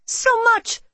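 high, loud anguished cry. Acted. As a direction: cry out to mom in despair. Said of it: "I cried".

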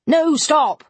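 sharp colonel breaking in; interrupting. A narrator's reading. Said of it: "interrupted Colonel Dent".

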